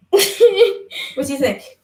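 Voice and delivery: slow words through laughter; laughing. Real, not acted. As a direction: ask it while giggling. Laughing at friend's attempt.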